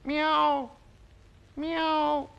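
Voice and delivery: high, loud meow; piercingly high kitty call; cat meow. Shown as a demonstration. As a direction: mew out loud like a cat for children to copy. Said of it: "And he goes".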